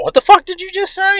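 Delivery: high-pitched incredulous retort; mock indignant challenge. Dad challenges rude neighbor, mock indignant. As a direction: imitate an outraged dad. Dad's retold angry challenge.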